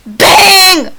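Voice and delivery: sudden loud, high "bang"; shouted gunshot noise. Pretend. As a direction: fire it out like a shot. Imitates gunshots during game.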